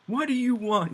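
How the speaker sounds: high, loud mock-panicked squeal. King to Roche before being stabbed, mock panic.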